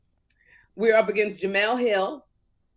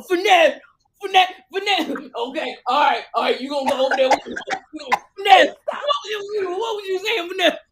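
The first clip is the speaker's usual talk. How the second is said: much higher and louder, teasing, laughing; calling Finesse out with a hammed-up squeal; mock calling out; 11 semitones higher, 5 dB louder than usual.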